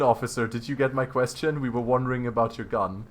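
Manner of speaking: ordinary talk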